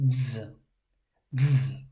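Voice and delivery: "Z" said as a D and a Z fused, dz; dz sound. Shown as a demonstration. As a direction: run a D straight into a Z. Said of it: "It's a D and a Z".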